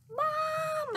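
high, loud drawn-out shout for Mom; kid's excited holler; yell. A narrator's reading. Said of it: "I yelled".

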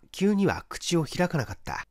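ordinary delivery

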